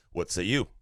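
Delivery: ordinary talk, nothing marked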